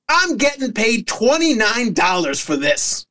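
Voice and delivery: high, dry deadpan complaint; tired ref shrugging her off; weary ref. Retold or imitated. Referee telling the mom his pay, high mock weariness. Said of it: "looks at her, and says".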